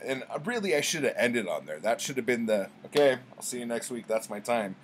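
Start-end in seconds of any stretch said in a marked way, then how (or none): 2.9-4.8 a quick fake stand-up closer; fast showbiz sign-off; mock comic sign-off. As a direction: wrap the set like a comedian.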